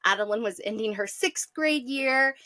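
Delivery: ordinary talk, nothing marked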